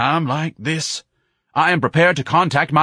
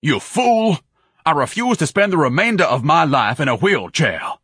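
loud, high pompous fury; screaming outrage; 8 semitones higher, 5 dB louder than usual. A narrator's reading. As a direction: berate the fool at full volume. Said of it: "Ignatius screamed".

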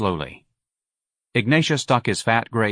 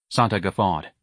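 usual speaking voice

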